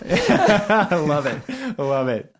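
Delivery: high, slow laughing delight. Real, not acted. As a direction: laugh out the words. Host laughs at the joke.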